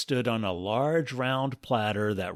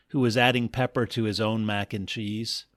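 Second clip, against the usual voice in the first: usual speaking voice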